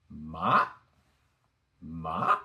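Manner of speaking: MA starting low and rising sharply; rising MA, like climbing a hill; second tone. Shown as a demonstration. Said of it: "Let's start from the beginning".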